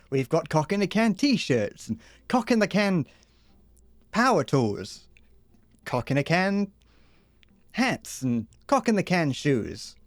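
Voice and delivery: hammed-up commercial voiceover; mock ad read. A streamer pitching a fake canned product, mock ad voice. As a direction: sell the product like a cheesy TV advert.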